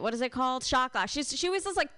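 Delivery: ordinary talk, nothing marked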